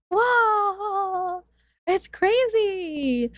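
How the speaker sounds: high, drawn-out wow; amazed excitement. Real, not acted. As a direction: marvel in a high, stretched-out voice. Amazed it's the last episode.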